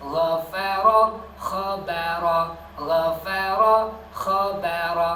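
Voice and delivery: Kharaqa rasped, gharaka gargled, all loud; loud pronunciation drill. Shown as a demonstration. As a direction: rasp kha and gargle ghain apart. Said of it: "Kha dengan ghain". (latihan kha dan ghain, keras)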